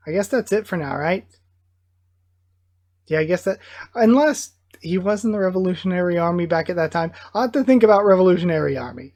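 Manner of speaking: normal talking voice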